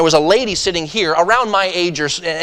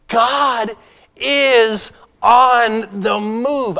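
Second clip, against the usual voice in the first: much higher, louder and very slow; triumphant proclamation; 7 semitones higher, 5 dB louder, much slower than usual. Retold or imitated. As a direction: stretch every word out in jubilant awe. Triumphant exclamation leaving the flight.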